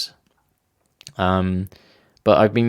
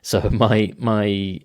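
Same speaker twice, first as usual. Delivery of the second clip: ordinary delivery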